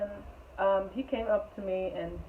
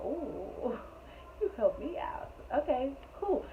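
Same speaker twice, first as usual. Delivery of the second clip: teasing ooh; 6 semitones higher, 5 dB quieter, slower than usual. Retold or imitated. Teasing his offer to help.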